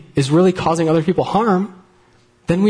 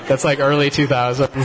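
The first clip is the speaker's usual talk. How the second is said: usual speaking voice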